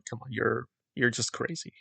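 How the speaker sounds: hushed scoff. Retold or imitated. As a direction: say it under your breath, dismissive.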